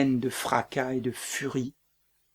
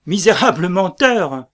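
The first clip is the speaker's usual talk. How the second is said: furious shout; 5 semitones higher, 12 dB louder than usual. Acted. Macbeth strikes the messenger, bellowing in rage. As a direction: roar it out, much louder and higher.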